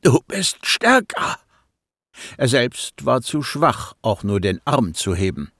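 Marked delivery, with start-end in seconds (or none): 0.0-1.4 higher, forced out through pain; pressed out with effort; strained. A narrator's reading. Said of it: "presste er hervor".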